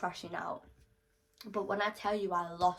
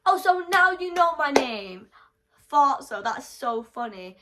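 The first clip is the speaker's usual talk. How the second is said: high, loud sarcastic jeer; mocking a rival who finally used her name; mocking sarcasm; 6 semitones higher, 12 dB louder than usual. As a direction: scoff at him mockingly. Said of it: "[name], what did I ever do to you?".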